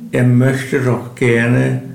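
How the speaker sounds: ordinary delivery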